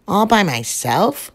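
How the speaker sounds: plain reading voice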